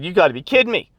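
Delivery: mock outrage at people blocking the view; exasperated disbelief. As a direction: throw up your hands at the rude fans. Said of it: "and I said".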